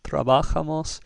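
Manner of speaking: trabajamos with the stress on "ba"; wrong stress, second syllable. Shown as a demonstration. Teacher showing a third wrong way, stress on BA. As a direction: punch the "ba" in trabajamos. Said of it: "it's not trabajamos".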